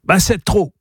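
exasperated cry. A narrator's reading. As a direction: exclaim it in protest. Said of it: "cria Turando".

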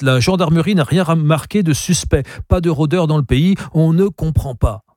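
annonce détachée, plus aiguë; voix indifférente. A narrator's reading. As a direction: dire ça sans s'y intéresser. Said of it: "annonçait d'une voix indifférente". (l'inspecteur annonce d'une voix indifférente)

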